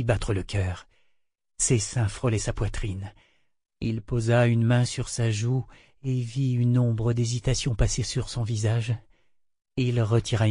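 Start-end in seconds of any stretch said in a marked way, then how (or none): none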